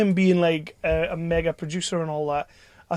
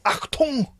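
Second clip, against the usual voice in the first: raised-pitch, barked German-accent command; mock German accent; 8 semitones higher than usual. Shown as a demonstration. Asking to call action on set, mock German accent. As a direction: call it like a comic German director. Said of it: "can I do it in a German accent".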